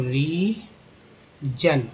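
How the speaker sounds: रीजन drawn out, ending जन; रीजन very slowly; slow clear word. Shown as a demonstration. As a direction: draw out रीजन for the class. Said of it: "जीएन को जन बोलते हैं".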